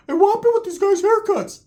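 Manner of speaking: very high, squeaky mock voice; high cartoon voice imitation; Ricky's voice. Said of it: "So Ricky tells me".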